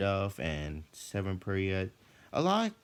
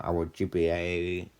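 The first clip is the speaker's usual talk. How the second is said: ordinary delivery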